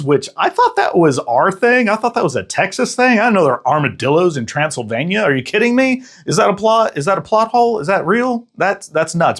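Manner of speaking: high, disbelieving questions; can't believe armadillos are in Transylvania; incredulous. Real, not acted. Incredulous about Texas armadillos.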